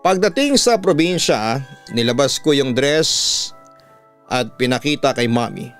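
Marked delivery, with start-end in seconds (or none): none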